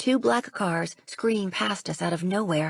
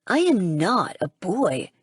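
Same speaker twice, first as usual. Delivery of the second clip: slower, louder, indignant; offended huff; 4 dB louder, slower than usual. Acted. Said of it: "he huffs out, sounding offended".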